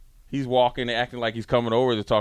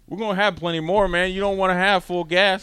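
pleading to chill; 10 semitones higher than usual. Retold or imitated. Telling his friend to slow down.

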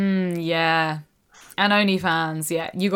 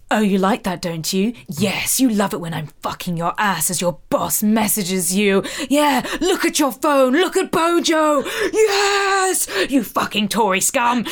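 posh British voice; 3 semitones higher than usual. Acted. Co-host acting out degrading the politician, posh British voice. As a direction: start controlled and posh British, climb to excited cries. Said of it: "posh British voice, be like".

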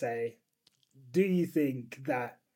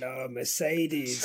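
dramatic sigh; 4 semitones lower, much slower than usual. Pretend. Drawn-out lament.

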